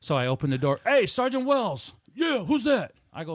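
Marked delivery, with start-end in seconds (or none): 0.8-1.9 high, drawn-out call through a doorway. Retold or imitated. Calling into recruiter's office. 2.1-2.9 high, raised answering call; calling back. Retold or imitated. Recruiter answers from his office.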